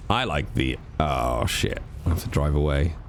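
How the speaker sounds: ordinary talk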